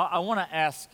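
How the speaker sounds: ordinary talk, nothing marked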